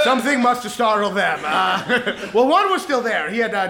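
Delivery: ordinary delivery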